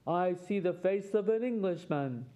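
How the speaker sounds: lightly voiced giant, mock shout; a hint of a hungry giant's shout. A narrator's reading. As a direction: play the giant calling out, a mild storybook boom. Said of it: "shouted the giant".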